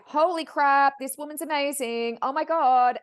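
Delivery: loud, high, awestruck outburst; retold amazement. Medium marvelling that this woman is amazing, bowled over.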